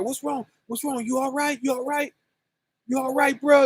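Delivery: a touch of a caring stranger; concerned helper. Retold or imitated. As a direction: check on the man gently and anxiously. Thugs rushing to help.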